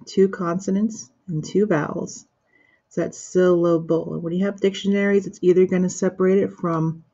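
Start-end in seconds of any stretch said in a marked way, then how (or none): 3.2-4.2 "syllable" in three separated parts, syl-la-ble. Shown as a demonstration. Teacher explaining dictionary separation, "syllable" sounded out part by part. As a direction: break "syllable" at each division. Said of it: "we have here a separation".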